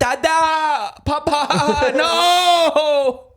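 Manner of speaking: high squealing mock wail; hammed-up childish shriek; mock cry for papa. Friend pretending to call for papa, high squeal.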